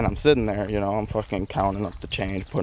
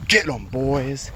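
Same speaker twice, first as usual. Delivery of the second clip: gruff command; 5 semitones higher, slower than usual. Retold or imitated. Owner sics dogs on him.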